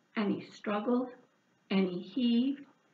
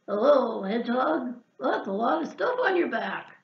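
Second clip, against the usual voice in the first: lightly croaky frog greeting; a hint of a frog's croak; 5 dB louder than usual. Frog greeting hedgehog, a hint of a croak. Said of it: "croaked Frog".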